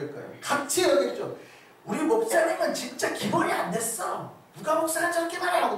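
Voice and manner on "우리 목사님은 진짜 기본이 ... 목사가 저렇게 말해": high-pitched, acted outrage; indignant complaint. Hypothetical young member complaining about the pastor, indignant.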